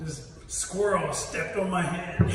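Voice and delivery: slow, puzzled telling; slow puzzled turtle. Acted. Turtle tells the rabbit what happened: slow puzzled. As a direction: drawl it out like a confused turtle.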